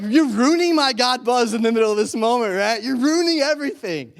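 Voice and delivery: high, loud hammed-up whining protest; exasperated complaint. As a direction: act outraged at the interruption. Acted complaint at the passing car.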